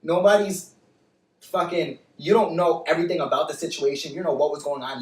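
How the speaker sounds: ordinary talk, nothing marked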